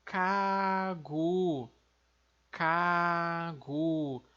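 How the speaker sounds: "kagu" com K no início e G no fim; coreano kagu. Shown as a demonstration. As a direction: diga "kagu" devagar, K surdo e G sonoro. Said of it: "vai ficar como". (pronúncia coreana de "kagu", devagar)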